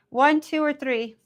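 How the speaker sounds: bright, loud and high playful count; excited. Shown as a demonstration. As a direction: call out the options eagerly. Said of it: "You guys tell me".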